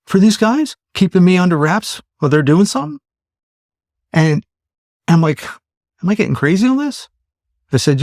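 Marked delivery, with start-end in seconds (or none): 0.0-3.0 high-pitched, rising suspicious questions; retold incredulity. Retold incredulous suspicion about the woman. 6.0-7.1 high, rising question to oneself; retold self-doubt. Retold self-doubt in the same scene.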